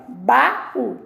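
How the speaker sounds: "Baú" split as ba, pause, ú; "Baú" in two distinct syllables; separated syllables. Shown as a demonstration. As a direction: give the ú of "Baú" its own beat. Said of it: "eu separo a sílaba".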